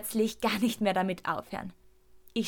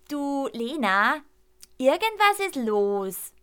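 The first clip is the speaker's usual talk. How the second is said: cautious; 4 semitones higher, slower than usual. A narrator's reading. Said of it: "meint Elias vorsichtig".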